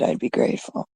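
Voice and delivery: breathy aside, barely voiced; hushed plea to the viewers; whispered. Real, not acted. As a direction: drop it to a whisper. Repeating the request, dropped to whisper.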